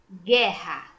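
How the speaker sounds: "Guerra" loud, hard G straight into E; "Guerra" enunciated loudly, no gw glide; careful sounding-out. Shown as a demonstration. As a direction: punch out "Guerra" with silent U. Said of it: "Não, né?".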